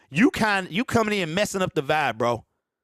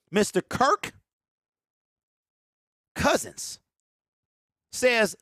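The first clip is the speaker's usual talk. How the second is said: usual speaking voice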